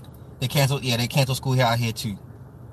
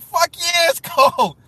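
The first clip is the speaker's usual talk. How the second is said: high-pitched mock outburst of agreement; emphatic retort; 13 semitones higher, 4 dB louder, slower than usual. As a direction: fire back in exaggerated, high agreement. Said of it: "I'm like".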